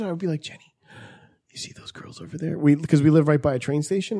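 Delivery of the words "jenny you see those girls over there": hushed unvoiced whisper to a friend; whispered aside. Whispering to his friend Jenny at breakfast. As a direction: lean in and whisper it secretly.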